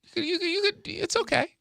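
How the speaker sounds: high, teasing reassurance; mock soothing. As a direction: soothe him like a child. Teasingly consoling the co-host.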